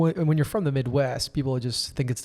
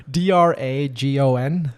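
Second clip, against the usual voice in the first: slow spelling; 5 dB louder, slower than usual. Shown as a demonstration. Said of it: "spelling the word".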